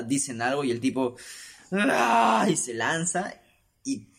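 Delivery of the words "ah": loud, high burst before lunging; sudden yell. Retold or imitated. Man in a movie lunging at someone with a loud high yell. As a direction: explode with a short cry.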